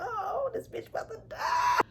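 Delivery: alarmed reaction. Real, not acted. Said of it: "I was like".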